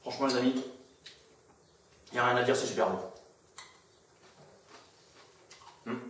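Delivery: talking while chewing cereal; muffled by food in mouth; mouth full, chewing. Real, not acted. Seen 1: Eats spoonfuls of cereal throughout, mouth working between bites.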